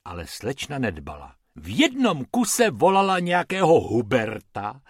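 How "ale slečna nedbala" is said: usual speaking voice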